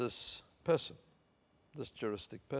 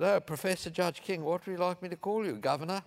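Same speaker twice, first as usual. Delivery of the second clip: imitating a Cockney air hostess, pitched much higher; a lilting Cockney flight attendant asking politely; higher, perky Cockney accent put on for the quote; 9 semitones higher, 4 dB louder than usual. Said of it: "sort of Cockney accent".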